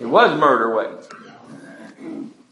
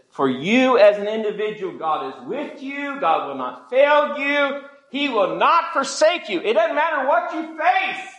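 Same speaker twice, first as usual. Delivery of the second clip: impassioned preaching; 11 semitones higher than usual. Real, not acted. Preacher assuring each listener of God's help, impassioned and high. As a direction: climb to a high, sustained cry.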